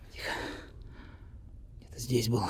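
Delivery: low unvoiced whisper; whispering while hiding. Real, not acted. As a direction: hush it, guard nearby. Hiding from guard.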